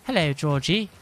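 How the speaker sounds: lightly mocked put-on accent; Faye's put-on accent. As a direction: imitate her faking a non-Birmingham accent. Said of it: "she's putting it on, I reckon".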